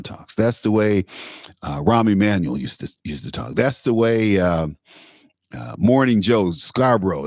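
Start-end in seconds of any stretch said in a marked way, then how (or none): none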